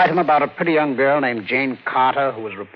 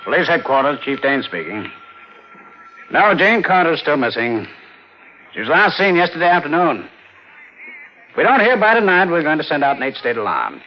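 louder, urgent official phone talk; brisk phone voice; 3 semitones higher, 5 dB louder than usual. Acted. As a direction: bark the facts down the line. Police chief on phone.

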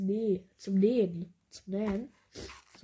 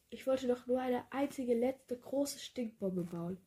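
usual speaking voice

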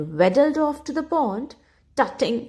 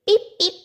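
high little peep peep; peeping chicks; 10 semitones higher, 3 dB louder than usual. A narrator's reading. Narrator mimics newborn ducklings peeping high. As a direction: chirp it like tiny ducklings.

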